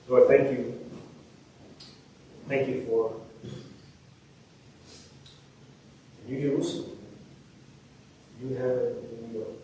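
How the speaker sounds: soft, low closing prayer. Real, not acted. Preacher leading the congregation in prayer, soft. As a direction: pray quietly over the room.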